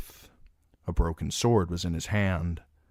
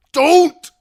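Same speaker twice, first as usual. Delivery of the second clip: very high, loud terrified shriek; 17 dB louder than usual. A narrator's reading. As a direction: scream for his life. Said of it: "shrieked Dick".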